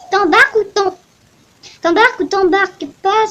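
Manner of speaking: put-on captain inviting the player, Russian accent. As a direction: offer the trip in a Russian accent. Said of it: "pourquoi je fais un accent russe?".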